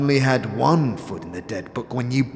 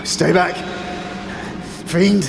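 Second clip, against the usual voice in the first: frightened shout; 7 semitones higher than usual. Acted. Corpse facing a fiend, shouting in fear. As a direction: shout it back in alarm.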